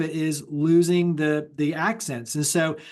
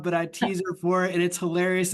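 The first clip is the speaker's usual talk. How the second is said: usual speaking voice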